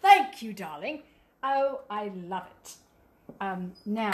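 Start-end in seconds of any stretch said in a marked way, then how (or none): 0.0-2.8 mock-regal British delight; queen-like. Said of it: "very queen-like".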